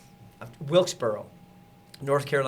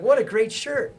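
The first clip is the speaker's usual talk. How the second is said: excited; 11 semitones higher, 6 dB louder, slower than usual. Retold or imitated. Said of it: "And I'm like".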